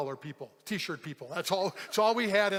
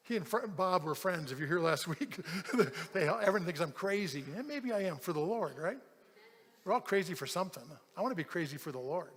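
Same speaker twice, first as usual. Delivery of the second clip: ordinary talk, nothing marked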